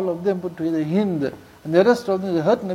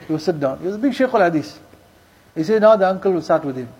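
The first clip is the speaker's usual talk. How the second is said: ordinary talk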